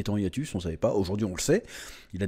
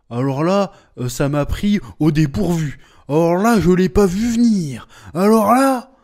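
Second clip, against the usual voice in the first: rising higher and louder each time; mock astonishment building; 5 semitones higher, 6 dB louder than usual. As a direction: build fake shock step by step. (stupeur jouée qui monte)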